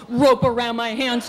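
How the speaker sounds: usual speaking voice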